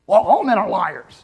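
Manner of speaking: mock the angry dumped girlfriend; dumped girl's retort. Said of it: "a girl who got dumped and got angry".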